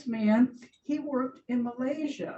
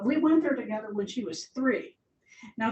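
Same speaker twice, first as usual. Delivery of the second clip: ordinary talk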